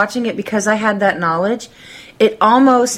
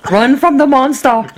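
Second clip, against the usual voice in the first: put-on Arnold Schwarzenegger accent; 4 semitones higher, 4 dB louder than usual. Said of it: "it went to Arnold".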